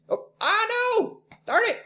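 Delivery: real high-pitched yelp of dismay; dismayed outburst. Real, not acted. Teacher who hit the wrong button mid-lesson, dismayed.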